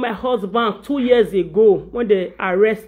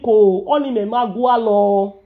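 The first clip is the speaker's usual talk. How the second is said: normal talking voice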